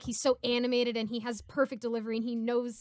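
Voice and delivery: ordinary delivery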